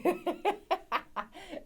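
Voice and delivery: high-pitched burst of laughter; amused laugh. Real, not acted. Amused by own catchphrase.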